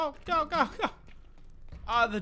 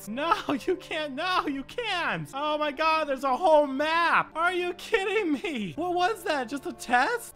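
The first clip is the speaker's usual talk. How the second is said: dismayed disbelief; 8 semitones higher, 4 dB louder than usual. Real, not acted. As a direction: protest the unfair surprise. Seeing more bosses.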